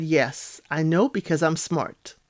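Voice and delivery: quietly pleased with oneself, mimicked; smug. Mike telling Megan he knows about Jordan, smug. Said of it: "Real smug".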